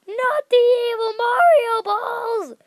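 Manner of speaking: high, loud, slow mock wail; hammed-up terror. Gamer dodging the evil Mario balls, mock terrified wail. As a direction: plead in over-the-top horror.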